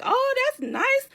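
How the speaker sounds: high, drawn-out "aww"-style approval; impressed gushing. Retold or imitated. Friend hears about the spa treats, gushing approval. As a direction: coo it slowly, way up in pitch.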